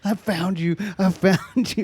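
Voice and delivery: high, put-on gloating mom voice; triumphant mom. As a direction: imitate a mom celebrating her find. Retold mom gloating on arrival.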